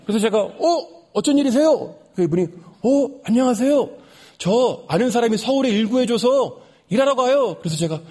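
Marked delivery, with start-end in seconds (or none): 0.4-2.0 high-pitched, pleasantly surprised greeting; glad surprise. Retold or imitated. Narrator meets the homeless man at the station, glad surprise. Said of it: "왠지 모르게 반갑더라고요". 2.4-7.6 high, eager retold greeting; glad greeting. Homeless man tells the narrator of his new job, gladly. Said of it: "이분도 제가 반가웠나 봐요".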